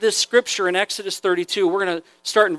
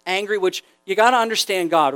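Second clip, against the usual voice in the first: ordinary talk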